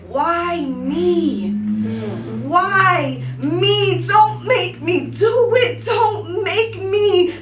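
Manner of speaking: stretched, climbing poetic cry of protest; crying plea. Acted. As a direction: wail it slowly, begging to be spared. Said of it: "I heard the bullet cry".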